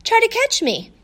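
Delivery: high teasing dare; playful taunt. A narrator's reading. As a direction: dare her to chase you. Dog taunts girl to chase.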